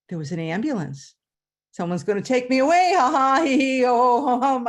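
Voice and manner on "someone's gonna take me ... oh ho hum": loud mock madhouse cackle; crazy laugh. Imagining the ambulance is for her, loud mock cackle. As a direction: cackle like you're being carted off. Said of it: "I thought in my head".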